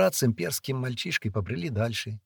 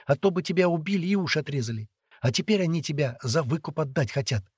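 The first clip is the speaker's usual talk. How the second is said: put-on higher, lively girl's voice; chattering young girl; 6 semitones higher than usual. A narrator's reading. Girl telling captive boy of ransom, chattering. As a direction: reassure him in a pitched-up chatter.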